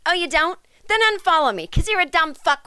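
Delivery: sarcastic comeback to a commenter; sarcastic retort. Real, not acted. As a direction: throw the question back with scorn. Snapping back at commenter.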